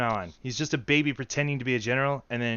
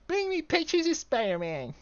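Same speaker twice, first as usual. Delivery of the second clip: put-on much higher toddler voice; baby voice; 14 semitones higher than usual. Shown as a demonstration. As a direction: demand it like a little child. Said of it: "What would that sound like?".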